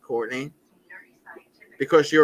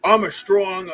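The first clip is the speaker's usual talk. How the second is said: mock cop, louder, higher, drawn-out words; imitating a cop; 7 semitones higher, 5 dB louder, slower than usual. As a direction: quote the cop's boast slowly and loudly. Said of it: "with the cop that said".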